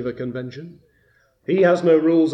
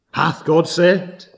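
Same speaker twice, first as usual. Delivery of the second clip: slow, louder questioning from the devil; tempter's challenge; 2 semitones higher, 6 dB louder, slower than usual. Acted. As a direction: press the doubt harder, drawing out each word. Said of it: "He comes and he whispers".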